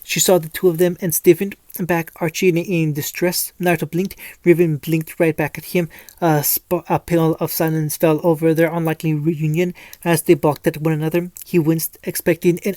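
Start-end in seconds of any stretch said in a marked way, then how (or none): none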